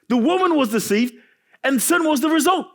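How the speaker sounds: dramatic emphasis. Shown as a demonstration. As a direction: voice climbs high on the result, overdone. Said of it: "I'm not sure why I said that like that".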